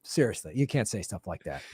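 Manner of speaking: deep, low, quiet menacing collector voice; mock YouTube enforcer. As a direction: lean in like a shady debt collector. YouTube rep scolding, mock.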